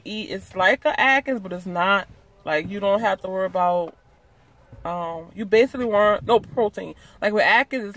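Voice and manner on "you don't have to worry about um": ordinary delivery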